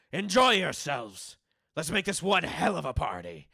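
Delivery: rally the partygoers with a big call; rousing yell to the crowd. Acted. Marith yells out to crowd.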